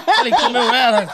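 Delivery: loud high laugh line. Real, not acted. Dwarf repeats his punchline, loud laugh. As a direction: burst out laughing.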